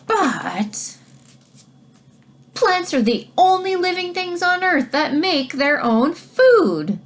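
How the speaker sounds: announcing a big fact loudly; quite loudly. Shown as a demonstration. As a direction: say it loud so everyone hears. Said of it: "I'll say this quite loudly".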